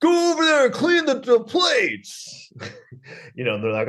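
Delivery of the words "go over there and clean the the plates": high, strained mock yell; hammed-up angry boss, pitch way up; mock screaming boss. Boss ordering kitchen workers about, mock screaming. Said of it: "screaming at them all the time".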